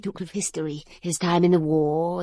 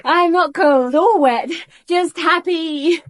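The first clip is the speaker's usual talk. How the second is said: higher, loud and joyful; elated; 7 semitones higher, 12 dB louder than usual. Acted. Woman arriving, loudly elated, telling her host she's happy. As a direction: burst in glowing with happiness.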